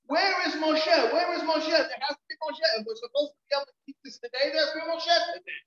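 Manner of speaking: high, loud, insistent shouting; retold screaming. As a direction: cry it out as her desperate demand. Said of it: "She starts screaming".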